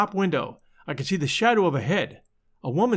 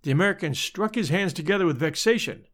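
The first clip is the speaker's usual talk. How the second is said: plain reading voice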